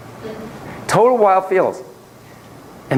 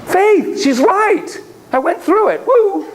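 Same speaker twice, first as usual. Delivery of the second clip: much higher and louder, triumphant; excited client; 14 semitones higher, 4 dB louder, slower than usual. Retold or imitated. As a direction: cheer it like a kid who just won. Voicing a client mid-session.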